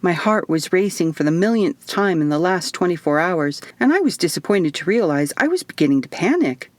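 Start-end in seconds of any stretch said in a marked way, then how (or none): none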